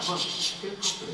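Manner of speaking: unvoiced hush to quiet him; calm him down with a shush. Real, not acted. Hushing the guest.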